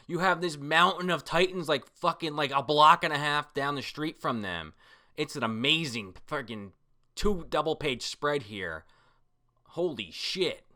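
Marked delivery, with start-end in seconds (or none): none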